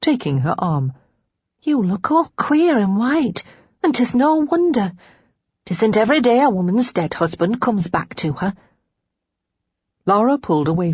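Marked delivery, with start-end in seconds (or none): 1.6-8.5 high maid's voice; wondering maid, pitched up; concerned, excited maid. A narrator's reading. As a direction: fuss over your shaken mistress.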